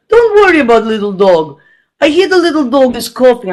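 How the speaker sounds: imitating a thick Russian accent; comic Russian-accented English; Russian neighbor. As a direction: play the dismissive Russian neighbor. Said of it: "one of my Russian neighbors".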